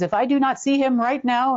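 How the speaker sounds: mock an angry demand without really screaming; retold mild scream. Retelling a man's hotel tantrum, mild mock yell. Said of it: "screamed at the top of his lungs".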